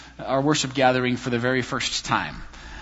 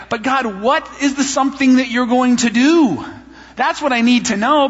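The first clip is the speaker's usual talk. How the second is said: high, louder pleading; pleading with God; 9 semitones higher, 5 dB louder than usual. Retold or imitated. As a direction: beg God for answers, exasperated.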